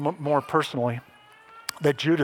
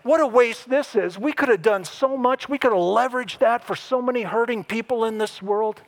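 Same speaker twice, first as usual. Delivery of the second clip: higher, a lightly voiced grumbling Judas; raised, mock-indignant protest; mock complaint; 6 semitones higher than usual. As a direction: act out Judas griping about the waste. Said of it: "he's the one who says".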